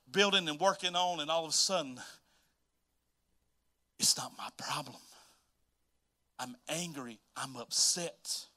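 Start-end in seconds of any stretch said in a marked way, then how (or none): 4.0-8.5 Joseph's bitter thought. Retold or imitated. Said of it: "Joseph's angry inner voice".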